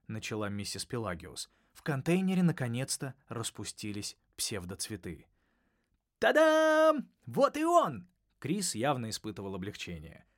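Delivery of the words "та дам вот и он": much higher, louder fanfare cry; triumphant ta-da. A narrator's reading. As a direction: announce the reveal with glee. Triumphant reveal.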